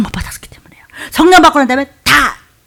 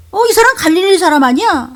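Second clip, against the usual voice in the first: acted accusation; 7 semitones higher than usual. Woman spots and accuses Peter.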